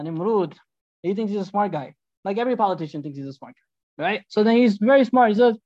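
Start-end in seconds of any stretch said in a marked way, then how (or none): none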